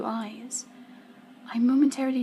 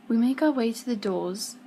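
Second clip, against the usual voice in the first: ordinary delivery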